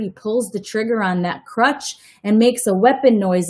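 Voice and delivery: ordinary delivery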